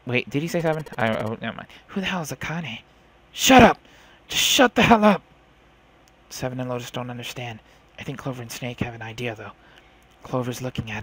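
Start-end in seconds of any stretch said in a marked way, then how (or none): 3.3-5.2 loud, high angry yell; furious shout. Acted. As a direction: scream at them to shut up. Angry outburst at others.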